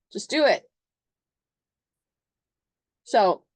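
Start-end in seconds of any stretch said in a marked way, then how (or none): 0.1-0.7 high, punched command; sudden raised-pitch push; impatient Aries. Acted. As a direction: cut the whining short with an order. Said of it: "Aries is like".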